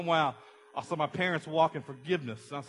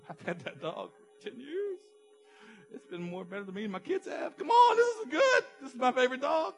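hammed-up wailing sob, pitched much higher and louder; crying over the dog; 10 semitones higher, 5 dB louder than usual. [name] wailing to the pastor about his dead dog, mock crying. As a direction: cry out like a heartbroken dog owner.

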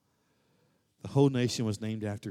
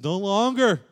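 God's proclamation; 5 semitones higher, 7 dB louder than usual. Acted. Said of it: "And God says".

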